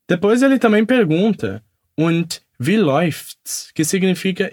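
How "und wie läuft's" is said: slow, careful question; slow demo; slow model line. Shown as a demonstration. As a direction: ask it slowly for learners. Teacher models German line slowly.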